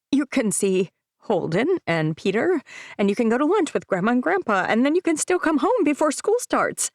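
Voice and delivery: anxious mom speeding up her pitch to her son. Acted. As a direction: babble to fill the silence, speeding up. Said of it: "Why am I speeding up?".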